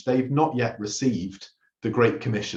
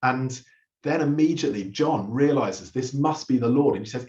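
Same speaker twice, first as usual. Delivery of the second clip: usual speaking voice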